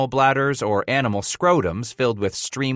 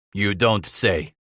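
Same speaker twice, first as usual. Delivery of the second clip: slow, grudging grumble; disgusted grumble; 2 semitones lower, slower than usual. A narrator's reading. Old German unimpressed by introduction, grumbling. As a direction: drag it out with mild disgust. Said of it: "Frabe grumbled with disgust".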